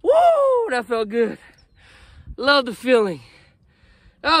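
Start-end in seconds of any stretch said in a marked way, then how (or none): none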